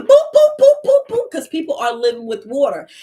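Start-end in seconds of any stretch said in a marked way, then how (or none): 0.0-1.3 high boop noises. Retold or imitated. Imitated sound effect.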